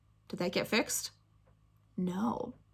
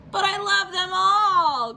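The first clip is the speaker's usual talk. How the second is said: slow, higher wailing plea; crying out; 9 semitones higher, 4 dB louder, slower than usual. Acted. Spencer crying out to mom to keep all toys, slow wail. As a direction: wail it like a heartbroken kid. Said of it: "cried Spencer".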